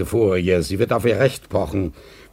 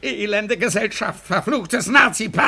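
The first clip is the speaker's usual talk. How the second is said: furious outburst; 9 semitones higher than usual. Acted. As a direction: spit the curses at him. Masur rages at Glienicke.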